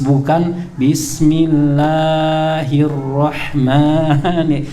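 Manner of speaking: bismillahirrahmanirrahim with vowels stretched far too long, very slow; sing-song child's overlong bismillahirrahmanirrahim; overlong madd. Shown as a demonstration. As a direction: drag every long vowel of bismillahirrahmanirrahim way past two beats. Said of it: "Kadar mat tobi'inya kepanjangan".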